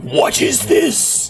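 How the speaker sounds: slow, whispered devil asking. Retold or imitated. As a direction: breathe it out slowly like a baffled devil. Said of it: "It's like".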